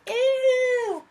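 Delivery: high, squealed mock disgust. Reader mocking a movie kiss, disgusted squeal. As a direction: squeal like a kid at a kissing scene. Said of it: "you'd be hiding your eyes going".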